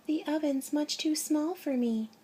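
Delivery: put-on high girl's voice; little girl voice. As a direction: pitch up for little Gretel. Gretel voiced in story.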